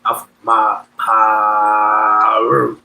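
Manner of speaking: imitating a homie drawling "Piru" extra long; drawn-out Piru. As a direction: drag "Piru" out long in a deep voice.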